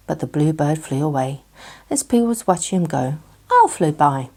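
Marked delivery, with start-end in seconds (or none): none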